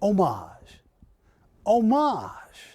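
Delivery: homage with silent H, oh-MAHZH; copied French pronunciation. Shown as a demonstration. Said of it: "the way you said it".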